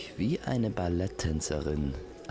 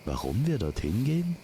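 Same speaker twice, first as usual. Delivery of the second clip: high, swooping questioning rise; lilting, higher-pitched echo of a question; 7 semitones higher, faster than usual. Acted. Echoes the listener's question back.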